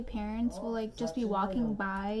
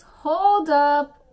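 much louder, higher burst; sudden exclamation; 4 semitones higher, 15 dB louder than usual. Real, not acted. Stopping herself while blending makeup, sudden loud exclamation. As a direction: blurt it out very loudly.

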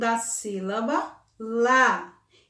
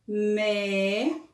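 reading syllables aloud; 3 semitones lower, much slower than usual. Shown as a demonstration. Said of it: "Vamos começar fazendo a leitura das sílabas".

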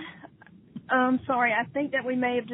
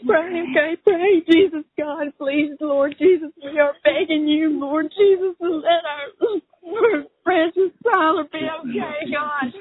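tearful prayer; 5 semitones higher, 9 dB louder than usual. Real, not acted. Mother leading family prayer for her son, crying. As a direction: lead the family in prayer, begging.

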